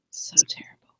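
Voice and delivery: hushed aside whisper. Real, not acted. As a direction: breathe it out under your breath. Whispered aside about terrible man.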